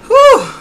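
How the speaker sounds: loud relieved whew. Real, not acted. Relief sigh.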